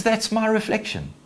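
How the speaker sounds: high-pitched objection; Simba's protest. Acted. Simba looking into the water, high-pitched protest. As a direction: pitch it right up, held high. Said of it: "He says".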